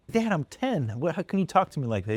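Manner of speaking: high, fast whiny protest; protesting kid. Retold or imitated. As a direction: plead like an offended ten-year-old.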